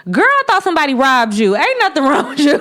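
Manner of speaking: high, loud, fast incredulous scold; mock exasperated father; blunt stepdad. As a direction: imitate the stepdad brushing it off.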